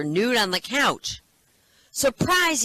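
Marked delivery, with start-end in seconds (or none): 1.9-2.6 high, loud surprise yell; gleeful shouted surprise. Acted. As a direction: burst in hollering it. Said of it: "he shouts".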